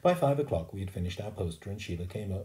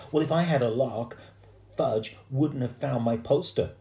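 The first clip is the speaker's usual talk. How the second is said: put-on louder, higher kid's protest; indignant arguing back; 6 semitones higher, 5 dB louder than usual. A narrator's reading. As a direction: argue back at your mother, raised voice. Boy arguing back at his mother.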